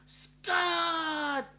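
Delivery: a long, high, loud shout of the name "Scott!"; big drawn-out call. Pretend. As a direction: belt the name out like calling across a beach. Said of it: "Hang on, I gotta do this right".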